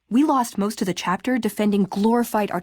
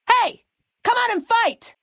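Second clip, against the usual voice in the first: angry taunt; 13 semitones higher than usual. Acted. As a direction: yell to draw the enemy out. Taunting hidden enemy.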